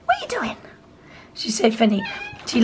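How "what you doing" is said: high, squeaky dog voice; voicing the dog. Owner voicing her dog's thoughts in a high squeaky voice. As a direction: speak for the dog in a tiny high voice. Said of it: "She's just".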